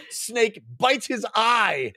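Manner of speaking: usual speaking voice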